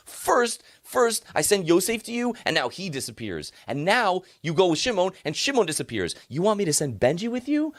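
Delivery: high, loud retold outburst of a grieving father; furious father. Yaakov rails at his sons over Binyamin, high and loud. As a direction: cry out that every child is being taken. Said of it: "Yaakov gets furious and says".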